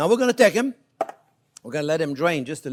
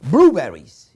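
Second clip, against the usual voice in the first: loud burst of remembering; sudden recall; 12 dB louder than usual. Real, not acted. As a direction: blurt it out as it comes to you. Finally recalling the right fruit.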